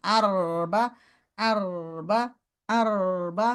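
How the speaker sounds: slow আরবা with a held trill; corrected r. Shown as a demonstration. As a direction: hold the r in আরবা before the next syllable. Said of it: "আরবা না, আরবা না". (র ধরে রেখে আরবা)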